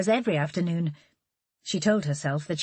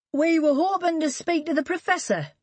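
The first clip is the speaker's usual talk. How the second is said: higher, louder smooth salesman voice; Charlie's voice; 11 semitones higher, 3 dB louder than usual. Acted. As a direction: Charlie smoothly cutting in before Holly. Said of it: "Charlie slid in before Holly".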